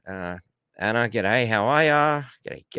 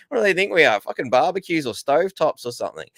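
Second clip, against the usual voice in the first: higher, louder indignant outburst; a hammed-up rant at being called a cooker; mock outrage; 7 semitones higher, 3 dB louder than usual. Retelling a yarn with a mate, mock indignant outburst. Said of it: "I'm like".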